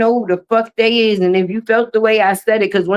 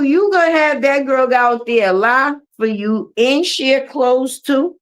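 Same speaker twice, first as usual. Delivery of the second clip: normal talking voice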